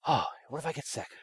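soft, fretful mock worry; a hint of panic, hushed; mock worrier. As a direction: play the anxious self quietly. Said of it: "just this".

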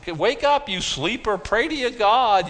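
high, frantic yell; panicked sailors. Acted. Frightened sailors shouting at the sleeping Jonah.

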